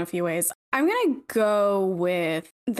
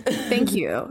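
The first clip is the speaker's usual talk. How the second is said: laughing thanks; 2 semitones lower than usual. Real, not acted. Laughing at the recommendation.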